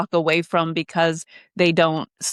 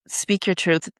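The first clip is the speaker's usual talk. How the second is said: ordinary delivery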